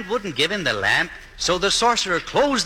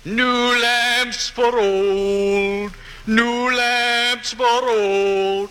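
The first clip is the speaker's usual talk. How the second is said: peddler's street cry; 7 semitones higher, much slower than usual. Acted. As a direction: sing it out like a street peddler hawking lamps. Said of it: "And he was crying".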